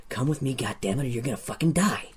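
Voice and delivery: soft, close, threatening growl; menacing hiss. Acted. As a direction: hiss it right in their face. Hobgoblin threatens close to face.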